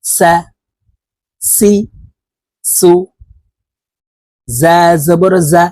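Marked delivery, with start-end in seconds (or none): 0.0-2.9 সা সি সু said with air pushed out through the স; breath out sin. Shown as a demonstration. Teacher drilling students on sin, air pushed out. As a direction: hiss each স with outgoing breath. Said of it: "বাহিরে বাতাস বের করে সিন হরফের উচ্চারণ".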